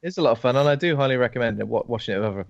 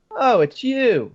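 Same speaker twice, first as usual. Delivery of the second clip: mock surprise; 12 semitones higher, 5 dB louder, much slower than usual. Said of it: "a lot of people go".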